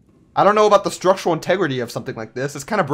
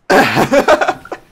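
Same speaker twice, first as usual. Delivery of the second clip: loud burst of laughter; 4 semitones higher, 11 dB louder than usual. Real, not acted. Gamer just leapt down, loud real laugh. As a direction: crack up loudly after a leap.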